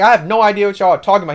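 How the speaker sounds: fast, high, loud protest; exasperated confusion. Retold or imitated. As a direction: rattle off your bafflement. Acted confusion, retold.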